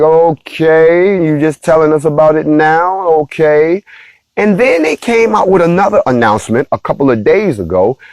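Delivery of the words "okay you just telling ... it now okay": sarcastic okay. Retold or imitated. Mock reply to officials' news.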